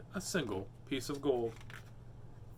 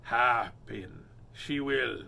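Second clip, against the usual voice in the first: very slow, lower pirate line; drawn-out pirate musing; slow pirate drawl; 5 semitones lower, much slower than usual. Acted. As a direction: stretch it out like a pondering pirate. Pirate line read slowly.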